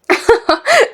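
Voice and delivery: very high female giggle; laughter. Acted. Young woman cannot help laughing at him, high giggle.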